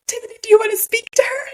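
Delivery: high, fast, put-on asking voice; imitated partner. Partner offering the phone mid-push, mock-eager.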